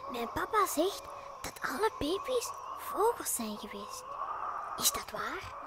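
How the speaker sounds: very soft timid whisper; cautious whisper. Acted. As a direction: ask it carefully in a whisper. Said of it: "vraagt hij heel voorzichtig".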